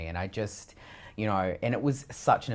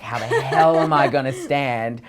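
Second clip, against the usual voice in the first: laughing quote; 4 semitones higher, 8 dB louder than usual. Retold or imitated. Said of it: "I was like".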